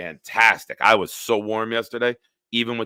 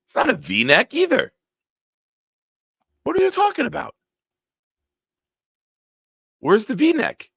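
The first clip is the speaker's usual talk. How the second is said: high, loud, baffled protest; incredulous; 4 dB louder than usual. Real, not acted. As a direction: push the pitch up in exasperated disbelief. Baffled, disputing the neckline.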